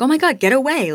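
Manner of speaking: high-pitched startled recoil, retold; retold alarm. Teller fending off a drunk guy's kiss, high mock alarm. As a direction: act out pushing off a creep, pitch jumping up.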